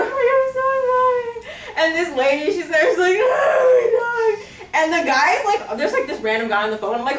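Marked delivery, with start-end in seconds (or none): 0.0-1.5 very high, drawn-out mock wail; bawling apology. Speaker to the dead dog's owner, hammed-up bawling. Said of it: "I'm bawling my eyes out". 3.2-4.5 very high, stretched-out crying wail; imitated wailing. Owner crying over her dead dog, imitated wail.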